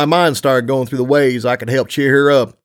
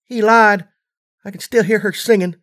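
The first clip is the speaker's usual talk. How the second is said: little filly voice; 8 semitones higher than usual. A narrator's reading. Filly's angry reply.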